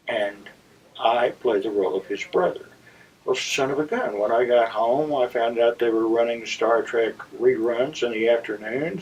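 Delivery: usual speaking voice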